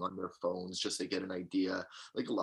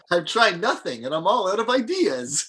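mock whiny voice; 10 semitones higher, 11 dB louder than usual. Joking quote, comedic exaggeration.

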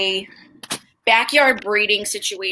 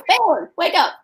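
talking to the dog; 8 semitones higher than usual. Real, not acted.

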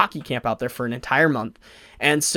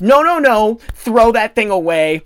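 higher and louder, urgent no no no; mock panicked pleading; 6 semitones higher, 6 dB louder than usual. Kid to TSA agent, mock panicked pleading. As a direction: beg them in a hurry to toss it.

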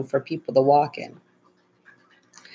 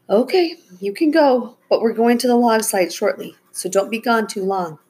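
higher, brisk motherly permission; lightly voiced mom; 6 semitones higher than usual. A narrator's reading. Mom lets the kids go walking, lightly voiced and higher. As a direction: start high, settle into mild instruction.

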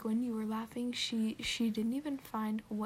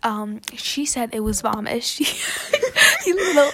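laughing mock scold; 15 dB louder than usual. Real, not acted. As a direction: yell it through laughter. Mock scolding friend while laughing.

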